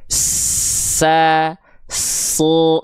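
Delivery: long drawn hiss opening SA and SU; hissing sin, sod. Shown as a demonstration. Teacher showing the hissing letters, sa and su with lengthened s. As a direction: hold the s as a long hiss before the vowel in sa, su. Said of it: "Yang punya sifat desis".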